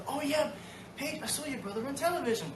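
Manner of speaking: high, put-on excited voice; mock excited schoolmate. Imitating schoolmates telling his sister they saw him, mock excited. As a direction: imitate a gossipy schoolmate, pitched right up.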